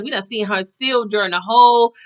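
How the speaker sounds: normal talking voice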